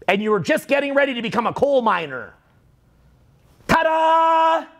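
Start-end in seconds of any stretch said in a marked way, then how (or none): 3.7-4.7 sing-song high ta-da; mock fanfare. Speaker mocking the arrival of the internet, sing-song reveal. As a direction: announce it like a magic trick.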